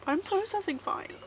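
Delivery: tearful, breathy, shaky words; trying not to cry while speaking; choked-up denial of tears; holding back tears. Real, not acted. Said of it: "Also, I'm not crying".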